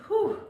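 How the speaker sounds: high, puffing exhale; breathless whew. Real, not acted. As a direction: blow out after hard effort. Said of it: "Let your heart rate come down".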